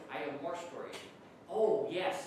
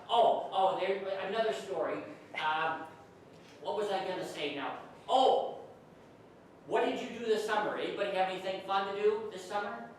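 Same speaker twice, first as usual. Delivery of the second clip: ordinary talk, nothing marked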